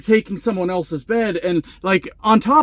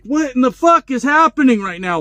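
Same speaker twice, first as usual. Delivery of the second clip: higher, louder freaked-out question; panicked disbelief; 6 semitones higher, 4 dB louder than usual. Retold or imitated. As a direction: sound like your head is spinning. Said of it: "I'm having a whole crisis in my head".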